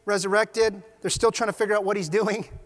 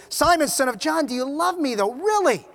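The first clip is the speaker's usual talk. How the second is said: raised, higher questioning; insistent questioning; 6 semitones higher, 6 dB louder than usual. Acted. Jesus asks Peter a third time, insistently. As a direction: press the question, loudest on the last word.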